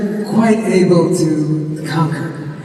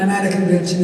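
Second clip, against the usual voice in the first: usual speaking voice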